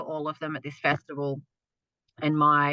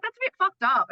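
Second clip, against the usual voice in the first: high, louder mock-disapproving voice; Mitch's reply; 13 semitones higher, 4 dB louder than usual. Partner Mitch reacting to her celebrating, mock disapproving. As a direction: voice Mitch taken aback. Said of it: "And Mitch was like".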